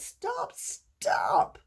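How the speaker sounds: very high, then a strained breathy stop; anguished cry. Acted. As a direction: cry out from the heat of the furnace. Cup burning in the furnace.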